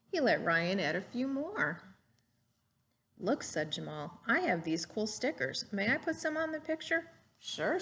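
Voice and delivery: lively storybook read-aloud; gentle classroom story voice; reading to children. Acted. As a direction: read it like a picture book to a class. Teacher reads picture book aloud.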